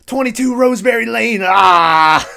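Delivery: high, loud mock scream; yelling. Imitating a popped-up face screaming an address, yelling. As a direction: yell the address, ending in a scream.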